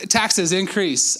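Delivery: normal talking voice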